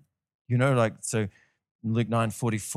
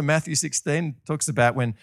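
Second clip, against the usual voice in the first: ordinary talk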